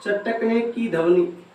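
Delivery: चटकने की ध्वनि said slowly and evenly; unhurried चटकने की ध्वनि for students copying it down; slow dictation. Shown as a demonstration. Repeats phrase slowly for dictation.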